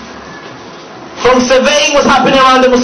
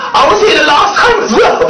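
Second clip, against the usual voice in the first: mocking quote; 9 semitones higher than usual. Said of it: "and she goes".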